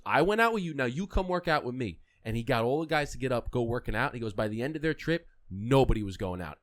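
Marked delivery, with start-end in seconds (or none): 0.1-2.0 high, loud and pointed, like a dare; louder teasing Kobe. Retold or imitated. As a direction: throw the line back at them with a grin.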